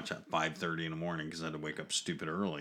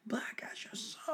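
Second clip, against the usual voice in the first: breathy spooky whisper; 6 dB quieter than usual. Pretend. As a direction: whisper it like a ghost story.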